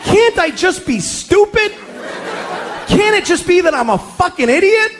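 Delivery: high-pitched indignant plea; exasperated pleading. Retold or imitated. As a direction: protest high, begging to be let off. Protests his wife's accusing question.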